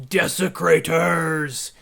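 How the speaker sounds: slow, drawn-out booming accusation; erupting voice. A narrator's reading. Game master as the voice from the sarcophagus, erupting. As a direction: let the word erupt slowly like a tomb voice. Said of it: "a voice erupted forth".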